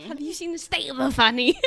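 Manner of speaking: loud, laughing British echo; mock British accent. Guest mimicking a line from the song, mock British. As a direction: repeat it in put-on British.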